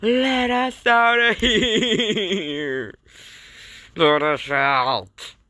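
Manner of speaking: high, drawn-out wail to be freed; slow, stretched cartoon cry for help; pleading call-out. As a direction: wail it out in hammed-up desperation. Trapped characters call to be released.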